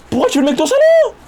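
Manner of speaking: very high, loud squeaky voice; loud high-pitched put-on squeal; mock squeal. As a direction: shriek the question in a cartoon voice. Mocking another character's question.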